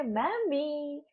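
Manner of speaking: soft, slow dreamy call. Pretend. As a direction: drift into a daydream, softly and slowly. Dreamy imagined kids calling.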